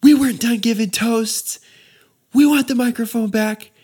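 high, mock shrill bride yelling about her wedding; imitating screaming bride. Retelling the bride screaming at the DJ, mock high-pitched yell. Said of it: "the bride's standing there screaming at me".

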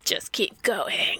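slow, hushed whisper; mock whisper. Cohost eerily urging the podcast on, whispered slowly.

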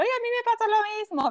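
high-pitched mock exclamation; imitating amused listener. Host imitating a listener who relates to the story, amused high exclamation. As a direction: squeal it in delighted recognition, as an imitation. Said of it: "se está riendo y diciendo".

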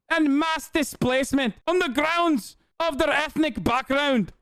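high, hammed-up Scottish accent; Eve Fartlow, Scottish accent. Streamer parodying a columnist's devotion, very high mock Scottish accent. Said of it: "Is that a Scottish accent?".

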